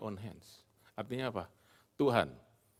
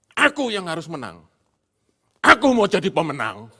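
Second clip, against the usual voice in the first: proud demand; 6 semitones higher, 14 dB louder than usual. Retold or imitated. Acting prideful self.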